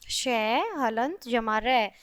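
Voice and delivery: "तय हलन जमा रे" said slowly, each part apart; teacher slowly spells out the parts for the children; slow spelling out. Shown as a demonstration. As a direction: sound out the parts of the conjunct slowly. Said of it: "श्रय बन जाएगा".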